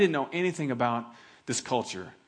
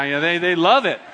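ordinary delivery

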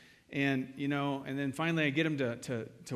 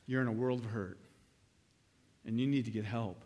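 ordinary delivery

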